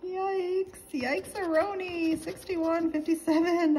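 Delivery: real rising alarm at the losing numbers; alarmed dismay. Real, not acted. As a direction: read the bad numbers out high in dismay.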